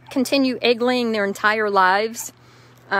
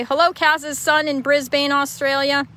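shout-out; 6 semitones higher, 4 dB louder than usual. Pretend. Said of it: "See, shouted him out".

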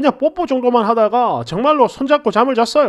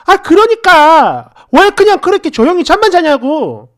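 put-on high, loud girlfriend outburst; angry retort; 6 semitones higher, 6 dB louder than usual. A narrator's reading. Girlfriend demands why he only slept, angry retort. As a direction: hammer the complaint at him, raised voice. Girlfriend yells back in acted quarrel.

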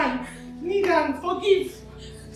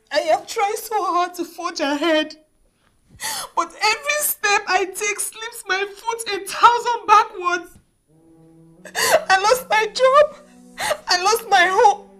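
crying, high-pitched despair; tearful lament; 7 semitones higher than usual. Acted. Single mother lamenting lost job, home and debt, tearful. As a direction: wail out your misfortunes through tears. Woman laments her ruined life.

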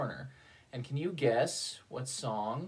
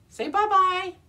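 much higher, louder bye-bye; high sing-song goodbye; 12 dB louder, slower than usual. Acted. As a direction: wave the children off brightly. Puppet farewell to kids.

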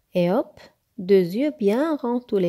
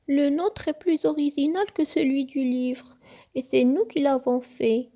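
high-pitched indignant defence; high, earnest storybook objection; protesting; 6 semitones higher than usual. A narrator's reading. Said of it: "protesta Peach".

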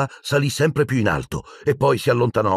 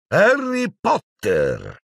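much higher, slow drawn-out name; stern call; 11 semitones higher, much slower than usual. Acted. Professor McGonagall calls Harry's name in shocked sternness. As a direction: call him out in alarm.

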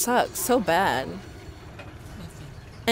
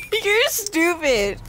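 laughing; 11 semitones higher, much slower than usual. Real, not acted. Laughing at someone's joke.

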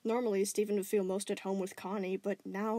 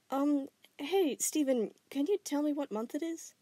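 higher, careful questioning; concerned girl; 7 semitones higher than usual. Acted. Connie carefully checks his awareness, concerned. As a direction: probe gently.

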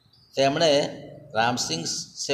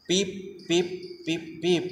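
high, light beeps like an alarm; a robot's warning signal beeping; 4 semitones higher, 5 dB quieter, faster than usual. A narrator's reading. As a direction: imitate a small warning beep.

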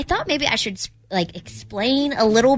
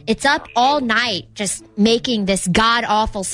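usual speaking voice

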